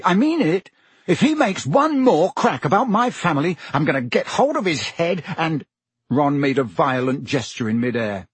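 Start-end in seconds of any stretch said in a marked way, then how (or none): 0.0-5.7 loud, higher furious threat; angry. A narrator's reading. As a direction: rage at him. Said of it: "he said angrily".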